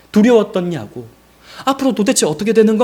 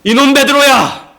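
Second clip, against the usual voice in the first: loud, high angry call; mock reproach; 9 semitones higher, 8 dB louder than usual. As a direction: shout his name like an acted scolding. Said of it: "책망하지 않으셨어요".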